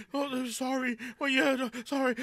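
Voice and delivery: high, flustered mock apology; hammed-up nervous backpedaling, pitched high; freaking out. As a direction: imitate a panicky string of apologies. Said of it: "He was all freaking out".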